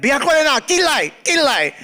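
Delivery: high, insistent nagging mother; nagging mom voice. Retold or imitated. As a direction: pester like a worried mom. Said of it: "all my mom kept on saying is".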